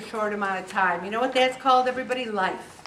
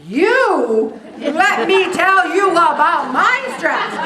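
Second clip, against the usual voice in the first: loud, slow, brushing the other person off; mock self-absorbed interruption; 6 semitones higher, 9 dB louder, slower than usual. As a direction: grab the spotlight, laughing. Friend hijacks the conversation.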